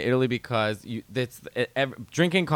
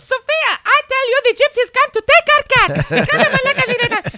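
put-on much higher, louder, fast shrieking mother with a Greek accent; yelling Greek mother; 7 dB louder than usual. Friend's mother yelling at Sophia through the door, shrill comic shriek. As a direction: screech a panicked warning at your daughter. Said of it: "yelled at her daughter".

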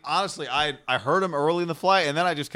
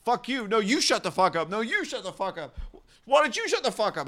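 high-pitched imitation of an argument; mock bickering; 9 semitones higher than usual. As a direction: act out two passengers trading insults. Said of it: "then they just start dropping".

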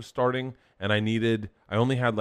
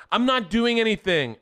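high, loud, drawn-out protest; acted indignant denial; 12 semitones higher, 6 dB louder than usual. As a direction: protest slowly like an exasperated wife. Acted quarrel, wife denies it.

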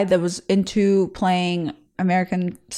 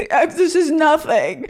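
high, strained mock bravery; holding back tears; 7 semitones higher, slower than usual. Bleeding girl reassuring her crush, high and near tears. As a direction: act fine while fighting back tears. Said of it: "I'm holding back the tears".

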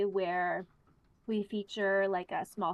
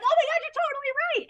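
very high, fast, louder gushing agreement; excited friend; 5 dB louder, faster than usual. Retold or imitated. Imitating friend agreeing to her big idea, excited. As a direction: squeal your agreement in a rush. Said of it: "And Laura was like".

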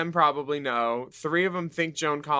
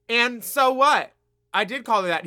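higher, louder mock-confrontational comeback; imitating a defiant retort; retold defiance; 8 semitones higher, 4 dB louder than usual. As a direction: draw out the challenge, ready to fight.

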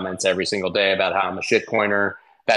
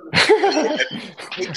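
short laugh. Real, not acted. Laughs at the connection trouble.

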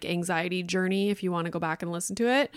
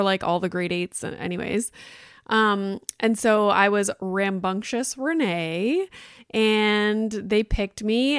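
ordinary talk, nothing marked

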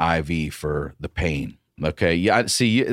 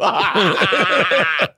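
high hysterical mock cackle; high-pitched unhinged laughter; crazed laugh; 10 semitones higher, 4 dB louder than usual. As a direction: cackle wildly like a tripping patient. Acts out neighbor's crazed laugh.

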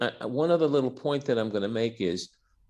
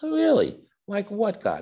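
higher, louder, surprised "Oh, really?"; mock surprise; 10 semitones higher, 4 dB louder than usual. Saint Peter questions God, in mock surprise. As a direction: Peter asks in exaggerated amazement. Peter reacts to God's plan.

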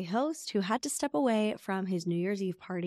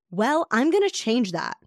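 slow, louder defiant vow; defiant renter; 4 semitones higher, 6 dB louder than usual. Retold or imitated. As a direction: dig in your heels.

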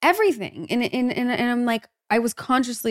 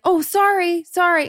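high, loud quick sorrys; reflex apology; 8 semitones higher, 5 dB louder than usual. Retold or imitated. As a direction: blurt out a startled apology. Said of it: "You'd be like".

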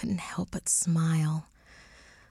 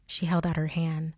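plain reading voice

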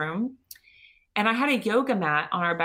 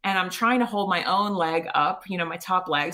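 ordinary talk

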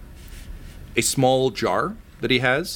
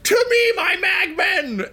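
yelled summons; 4 dB louder than usual. Acted. Poop Lord summoning his Magmen, high commanding yell. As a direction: yell it high, stretching the words. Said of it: "he will yell".

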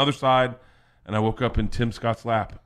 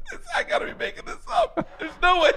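laughing. Real, not acted. Laughing at own story.

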